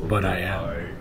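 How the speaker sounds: low, soft, assured villain reply; a calm, smug hint of Vecna; quietly certain monster voice; confident villain. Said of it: "he's really confident".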